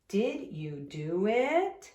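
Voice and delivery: Did you do it, slow, voice climbing on it; rising question tune. Shown as a demonstration. Teacher showing how a question rises. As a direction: lift your voice up at the end. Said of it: "My voice goes up at the end".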